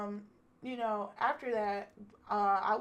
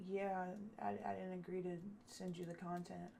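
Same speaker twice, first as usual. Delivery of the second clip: soft, halting backing out; evasive refusal; 2 semitones lower, 9 dB quieter than usual. Retold or imitated. Man refusing to send her the content, evasive refusal. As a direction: imitate someone quietly wriggling out.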